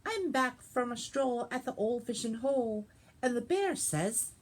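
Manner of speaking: put-on lively read-aloud for children; animated picture-book reading; story narration. A narrator's reading. Librarian reads picture book.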